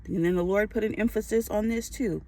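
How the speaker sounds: ordinary talk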